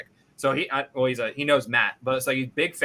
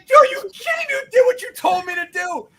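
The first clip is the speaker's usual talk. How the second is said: near-screaming, much higher and louder, in disbelief; incredulous angry yell; 7 dB louder than usual. Retold or imitated. Golfer yelling at his caddy after a lost ball, furious shout. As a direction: blow up at the caddy who gave the bad advice.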